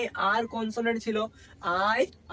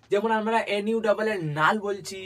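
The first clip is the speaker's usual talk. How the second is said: usual speaking voice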